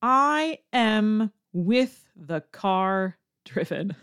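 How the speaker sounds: very slow, word by word; slow literal translation for learners. Shown as a demonstration. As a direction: spell out the awkward word order slowly. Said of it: "Translated directly to".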